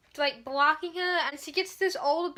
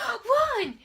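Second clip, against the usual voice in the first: higher, hammed-up command to run; mock urgent yell; 8 semitones higher, 3 dB louder than usual. Mimicking Tris telling everyone to flee, a higher mock yell. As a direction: bark it like a movie heroine.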